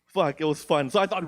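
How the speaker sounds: usual speaking voice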